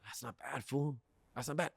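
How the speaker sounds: softly impressed, under the breath; quiet approval. Retold or imitated. Customer weighing up the dealer's monthly price, quietly approving. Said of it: "I'm like".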